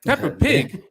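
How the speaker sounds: high, loud incredulous echo. Real, not acted. Host teased about his accent by co-host, incredulous. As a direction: repeat it in offended disbelief.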